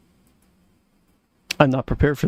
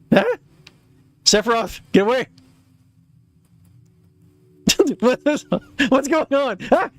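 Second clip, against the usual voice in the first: panicked shout; 9 semitones higher than usual. Acted. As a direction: yell at him to back off in alarm. Character panics and flees.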